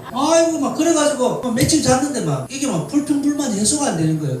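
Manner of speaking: ordinary talk, nothing marked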